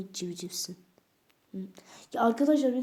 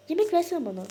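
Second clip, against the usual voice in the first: cat voice; 9 semitones higher, 7 dB louder, faster than usual. Pretend. Cat voice asks food.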